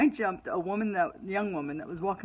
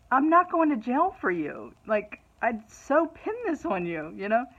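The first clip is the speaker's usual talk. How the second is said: higher, louder retold telling-off; retold emphatic refusal; 6 semitones higher, 7 dB louder than usual. Mother refusing jail for her daughter, mock-indignant and loud. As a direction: put your foot down, acted up for the retelling.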